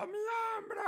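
high mock yell of a call for help; retold shout. Father reenacting his friend's cry after the crash, high mock yell. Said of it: "así gritando".

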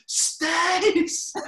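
high, loud call across a bar; shouting a name. Retold or imitated. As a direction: yell her name to get her attention. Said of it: "And Hone is shouting at her".

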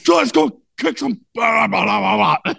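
high, strained imitation of rallying cry; hammed-up hype speech dissolving into babble; mock pep talk. Host retelling the receiver's locker-room speech, mock fired-up.

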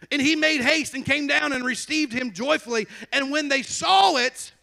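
loud, high declamation from the Bible; forceful scripture preaching. Real, not acted. Preacher reading Zacchaeus's story to church, forceful declamation. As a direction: proclaim the verse, stretching the last words.

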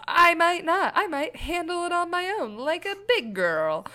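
high playful voice; sing-song proud little kid; little girl pride. Pretend. Boasting she can handle the car herself like a big girl.

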